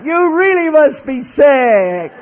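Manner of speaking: high, loud, drawn-out mock wail; Ralphie's greeting. Ralphie carried back in, wailing at the priest still there. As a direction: cry it out in shocked pity. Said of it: "Ralphie comes back and he goes".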